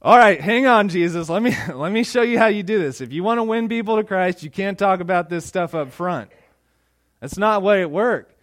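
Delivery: mock know-it-all. Preacher mock-corrects Jesus.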